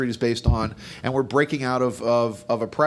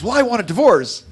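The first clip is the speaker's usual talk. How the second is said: high, louder wife demanding a divorce; 10 semitones higher, 6 dB louder than usual. Retold or imitated. Wife in the joke demanding divorce, high and indignant. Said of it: "And the woman says".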